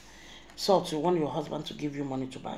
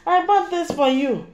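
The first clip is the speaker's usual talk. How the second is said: high, loud put-on sweetness; mocking girlfriend's sugary gift line; mock sweet voice; 10 semitones higher, 9 dB louder than usual. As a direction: coo it like a flatterer. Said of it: "dey call sweet name".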